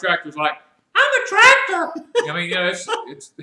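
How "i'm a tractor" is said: very high, loud falsetto; mock female voice, pitched way up; female tractor voice. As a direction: squeal it as a girly tractor. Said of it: "My tractor's got a female".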